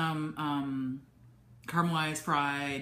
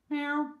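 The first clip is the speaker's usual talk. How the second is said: high playful meow to the cat; 10 semitones higher than usual. Real, not acted. Meowing at her cat.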